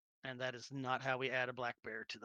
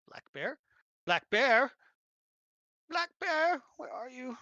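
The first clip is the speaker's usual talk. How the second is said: calling out; 11 semitones higher, 11 dB louder, slower than usual. Real, not acted. Calling out for the token.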